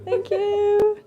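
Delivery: high, loud, drawn-out call; big playful shout of thanks; loud thank-you. Shown as a demonstration. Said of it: "Скажи громко".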